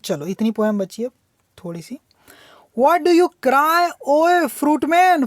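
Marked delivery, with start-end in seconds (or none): 2.8-5.2 poem reading. Shown as a demonstration. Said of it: "teacher reads poem line aloud".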